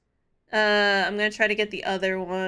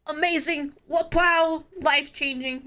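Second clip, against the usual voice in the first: higher, overexcited fake praise; a hammed-up rave review; mock gushing; 5 semitones higher than usual. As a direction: gush like it changed your life. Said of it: "It's, like, not like".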